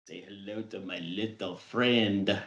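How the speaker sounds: put-on low, quiet Cuban-accented film quote. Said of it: "You do the accent pretty well".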